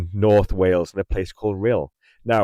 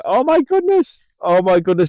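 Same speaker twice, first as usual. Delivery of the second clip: overwhelmed surprise; 10 semitones higher, 6 dB louder than usual. Real, not acted. More gifts arrive on stream.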